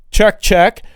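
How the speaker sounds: loud mic check. Real, not acted. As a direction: call out a sharp check, check. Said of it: "Let's make sure it's working".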